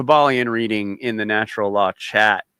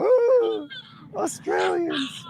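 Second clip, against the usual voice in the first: very high, drawn-out mock swoon; imitated charmed Americans; 3 dB quieter, much slower than usual. Americans charmed by the accent, imitated high swooning. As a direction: coo like a besotted fan. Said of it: "Americans are just all".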